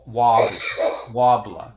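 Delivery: "wobbler" in a loud Boston accent with the R dropped. Shown as a demonstration. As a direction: blurt out "wobbler", Boston style. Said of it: "it's like".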